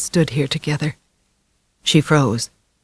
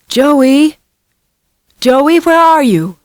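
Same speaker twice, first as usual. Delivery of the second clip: high, loud and drawn out; mother calling for her lost son; 12 semitones higher, 6 dB louder, much slower than usual. A narrator's reading. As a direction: call into the dark woods. Mother searching woods calling.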